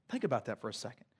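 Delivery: ordinary talk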